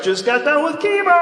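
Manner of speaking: high-pitched, called-out excuse; mock announcement. Audience member brushing off the comic, high mock call. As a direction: call it out high to fend someone off. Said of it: "be like".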